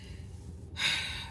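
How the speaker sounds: soft weary exhale; heavy sigh. Real, not acted. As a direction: sigh out the frustration. Feeling all over the place.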